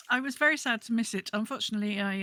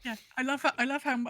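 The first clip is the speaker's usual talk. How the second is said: usual speaking voice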